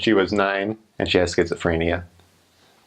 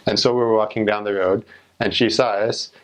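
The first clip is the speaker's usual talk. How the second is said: ordinary delivery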